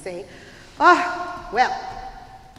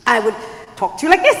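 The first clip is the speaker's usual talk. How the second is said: hyper rooster; 2 semitones lower, 10 dB louder, much faster than usual. Acted. Gallo answering Martina's question, hyper rooster voice. Rooster demonstrates his talk.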